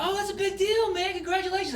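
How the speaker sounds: high, hammed-up congratulations; overplayed high-pitched cheer; mock excitement. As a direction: gush like you're thrilled for him. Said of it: "I'm like".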